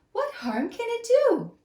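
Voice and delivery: high, squeaky mouse voice; a tiny mouse sneaking past; little mouse thinking. Acted. Mouse plotting a shortcut, high and squeaky. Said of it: "he thought".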